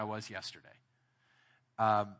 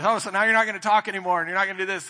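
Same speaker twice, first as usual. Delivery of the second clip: higher, louder, quicker accusing snap; exasperated wife; 6 semitones higher, 7 dB louder, faster than usual. Retold or imitated. Retelling how his wife snapped at him in the car, exasperated. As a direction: throw his silence back at him, fed up.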